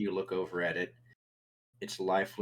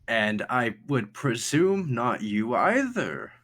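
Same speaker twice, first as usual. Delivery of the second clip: slow, higher, pointed character voice; Thatch; 5 semitones higher, 4 dB louder, slower than usual. Acted. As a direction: draw it out with a rise near the end. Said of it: "As he kinda looks at you and says".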